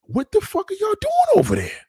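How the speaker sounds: high, loud incredulous yell; incredulous shout. Retold or imitated. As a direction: shout it like you can't believe what they're doing. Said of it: "people from the U K that was like".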